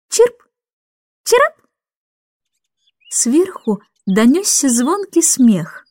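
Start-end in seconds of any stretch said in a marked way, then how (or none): 0.1-1.5 tiny high bird chirps; chirping lark. A narrator's reading. The lark calls down to Miss Andrew, high chirps. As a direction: pipe two quick high chirps. Lark's call.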